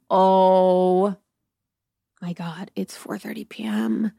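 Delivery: long drawn-out "Oh", then hushed; mock dismay. Woman learning it is only afternoon, mock dismay. Acted dismay at the early hour.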